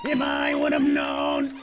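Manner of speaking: flat mechanical buzzing monotone; monotone robotic voice box; robotic throat-box voice. Retold or imitated. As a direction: speak through an electrolarynx. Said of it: "laughing in your fucking".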